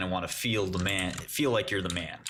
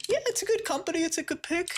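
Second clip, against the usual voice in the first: very high, squeaky put-on voice; mock meek agreement. Replying to the all-in stock picker, mock meek agreement. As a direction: concede it in a tiny high-pitched voice.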